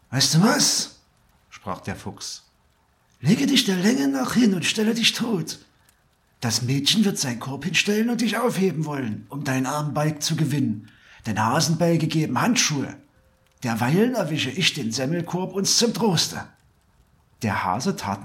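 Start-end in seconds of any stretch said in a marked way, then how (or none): 0.1-1.0 high, loud bright idea; animated fox voice; scheming fox. A narrator's reading. Fox has a sudden scheme. 3.2-16.5 high, quick fox voice; animated high-pitched plotting; scheming fox. A narrator's reading.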